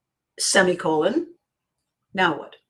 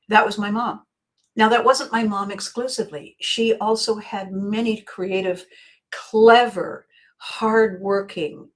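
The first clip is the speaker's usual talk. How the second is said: ordinary talk, nothing marked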